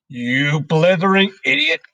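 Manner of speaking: loud, high Trunchbull insult; enraged headmistress roaring abuse; furious shout. A narrator's reading. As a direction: bellow it at the pupil with rising pitch. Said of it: "shouted the Trunchbull".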